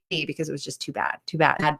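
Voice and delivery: ordinary delivery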